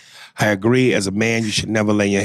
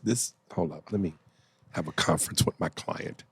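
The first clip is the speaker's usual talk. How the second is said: off mic; 5 semitones lower, 12 dB quieter than usual. Real, not acted. Muted aside off mic.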